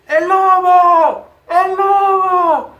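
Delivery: high, drawn-out cries for help; loud, high, stretched-out alarm call; frightened shouting. A narrator's reading. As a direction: scream for help as the scared shepherd boy. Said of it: "corrió en busca de ayuda gritando".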